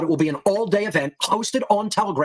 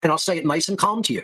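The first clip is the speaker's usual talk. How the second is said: ordinary delivery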